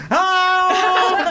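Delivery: high, loud, laughing "Oh!"; a squeal of being recognised; delighted surprise. Retold or imitated. Soap actress reacting to a fan's double take, delighted. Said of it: "she had a real, like".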